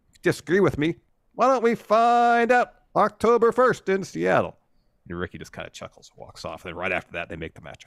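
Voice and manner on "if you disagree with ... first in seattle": loud, high wrestling-promo shout; hammed-up wrestler calling out a rival; mock promo challenge. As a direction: build to a big stretched-out challenge.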